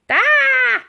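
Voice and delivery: high loud alarmed shriek; startled cry. A narrator's reading. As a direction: cry out as you are knocked spinning. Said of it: "they cried".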